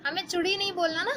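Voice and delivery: ordinary talk, nothing marked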